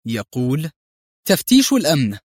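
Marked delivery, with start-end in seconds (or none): 1.3-2.2 higher, louder stern female guard; stern woman. A narrator's reading. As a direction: bark the order like a strict officer. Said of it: "صوت أنثوي صارم".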